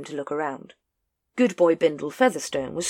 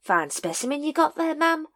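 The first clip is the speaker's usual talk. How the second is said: cheeky; 11 semitones higher than usual. A narrator's reading. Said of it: "playing the cheeky chirpy".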